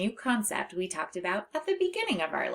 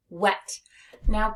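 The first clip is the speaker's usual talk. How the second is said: ordinary talk, nothing marked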